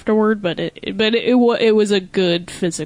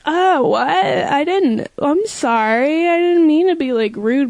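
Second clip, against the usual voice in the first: high, fake-surprised sorry; mock innocent apology; 7 semitones higher than usual. Mocking the coworker's fake innocent apology to customers. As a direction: play dumb in a high sweet voice. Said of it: "she would act like".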